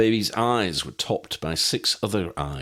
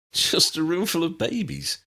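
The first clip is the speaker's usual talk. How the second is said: nervous half laugh; 11 semitones higher than usual. A narrator's reading. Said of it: "sounded as though he was about to laugh".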